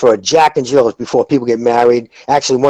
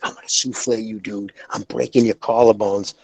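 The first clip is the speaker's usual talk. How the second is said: low, much softer menacing mutter; quiet mock threat; 5 semitones lower, 7 dB quieter than usual.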